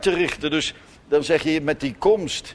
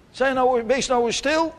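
put-on higher, calling out to hush the children; parent calling up the stairs; 9 semitones higher than usual. Parent at the foot of the stairs hushing noisy kids, calling up. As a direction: call up the stairs, stern but not screaming.

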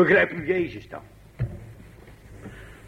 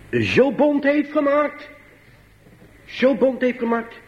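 high, held pulpit tone; emphatic preaching; 10 semitones higher, slower than usual. Acted. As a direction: stretch it out high, almost chanted. Preekt met nadruk. (nadrukkelijk preken, hoog aangehouden)

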